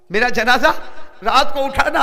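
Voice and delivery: dying wife's request, cried out by the preacher; fervent loud repeat. Acted. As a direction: cry the request out to the crowd.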